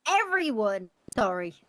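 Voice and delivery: normal talking voice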